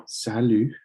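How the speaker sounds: salut with no final t; French salut. Shown as a demonstration. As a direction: model salut cleanly. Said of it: "You don't pronounce the T".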